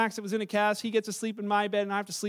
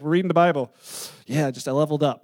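lightly acted, lower-pitched parent; parent's feigned excuse; 7 semitones lower, 5 dB louder, faster than usual. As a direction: cover up phone gaming with a low mock-serious reply. Parents' excuse about phone use, mocked.

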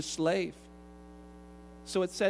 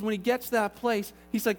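normal talking voice

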